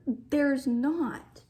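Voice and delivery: ordinary delivery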